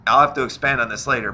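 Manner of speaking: usual speaking voice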